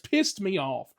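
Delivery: ordinary talk, nothing marked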